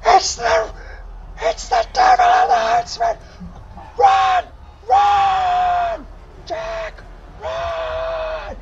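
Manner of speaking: very high, throat-tearing shout; top-of-lungs screaming. Acted. Captive warning Jack to run, desperate full-force scream. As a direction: scream the warning with everything you have. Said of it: "He shouts the top of his lungs".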